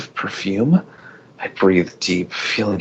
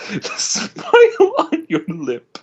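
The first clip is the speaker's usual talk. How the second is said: crying; 13 semitones higher, slower than usual. Acted. Longing confession to her sister.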